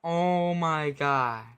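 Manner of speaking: slow, drawn-out, loud "oh my God"; stunned disbelief. Real, not acted. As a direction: let it sink in slowly. Stunned after loss.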